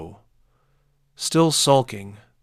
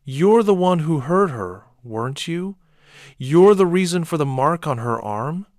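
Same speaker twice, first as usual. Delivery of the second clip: higher, louder furious accusation; father accusing the neighbor, higher and louder; accusing shout; 7 semitones higher, 6 dB louder than usual. A narrator's reading. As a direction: pin the blame on him, loud.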